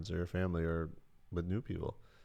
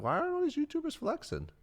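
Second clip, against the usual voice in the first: high wondering voice; 10 semitones higher, faster than usual. Retold or imitated. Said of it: "I'd be like".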